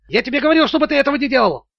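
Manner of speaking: high, agitated outburst; indignant squeal. A narrator's reading. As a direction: squeal the accusation in outrage. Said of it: "завизжал финансовый директор". (возмущённый визг)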